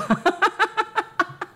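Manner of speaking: high falling laugh; laughing at the inspector's excuse. Real, not acted. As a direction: laugh at the silly answer. Laughing at mosquito answer.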